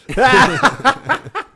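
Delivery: high, loud burst of laughter. Real, not acted. Laughs after retelling the insult.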